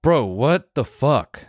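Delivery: slow, drawn-out mock disbelief; shocked friend. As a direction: stretch it out in stunned disbelief. Buddy shocked in locker room.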